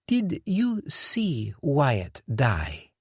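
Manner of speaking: sinister slow French boss; cold menacing boss. Acted. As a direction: ask it slowly like a threat. Dufour cold question.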